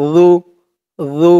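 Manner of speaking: "জু" with tongue tip at the upper teeth edge; tongue-tip dhal. Shown as a demonstration. Teacher showing learners the letter, careful dental "জু". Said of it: "জালের উচ্চারণটা খেয়াল করবেন".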